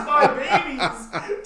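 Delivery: high, loud, slow cartoon cry; hammed-up doting cry; imitated doting wail. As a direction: wail it through laughter. Repeats Mister Krabs line, laughing.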